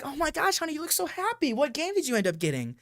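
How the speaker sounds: high-pitched gushing mother voice; put-on excited mom; delighted mom. Acting mom's delighted greeting.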